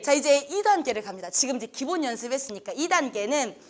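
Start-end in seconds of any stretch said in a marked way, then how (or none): none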